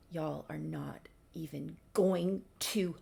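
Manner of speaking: whispered, slow and secretive; conspiratorial whisper. Real, not acted. Vlogger teasing viewers about a twist, whispering. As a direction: lean in and whisper the teaser.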